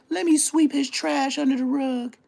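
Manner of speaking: high put-on voice mocking him; mocking his excuse in a high put-on voice; mock inner voice. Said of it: "You did like everybody else".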